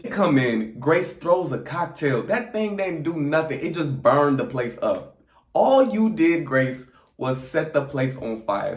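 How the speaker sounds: ordinary delivery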